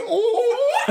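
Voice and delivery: mock surprised yelp. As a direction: squeal it like a startled yelp. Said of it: "He's like".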